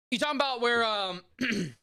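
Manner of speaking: halting, high mock Walken cadence; lightly done Walken; Christopher Walken impression. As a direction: ease into a Walken voice with odd pauses. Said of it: "my impressions do turn into Christopher Walken".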